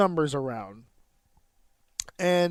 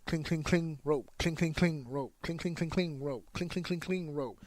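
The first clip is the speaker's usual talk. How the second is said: quick, light run of "cling"s, each set ending on "rope"; sped-up cadence; 6 dB quieter than usual. Shown as a demonstration. Trainer demonstrating rope-running footsteps, sped up. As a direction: rattle off "cling, cling, cling, rope" rapidly in rhythm. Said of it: "Now, you can speed it up".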